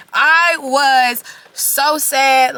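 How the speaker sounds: drawn-out slow sad moan; mock sad wail. Real, not acted. Recalling that night, a slow hammed-up sad moan. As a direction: stretch it out like a sulking wail.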